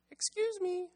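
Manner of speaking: tiny, very high bird voice; sparrow. A narrator's reading. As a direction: squeak it out like a little sparrow. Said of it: "said the sparrow".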